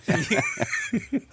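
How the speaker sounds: high burst of laughter; loud high laugh. Real, not acted.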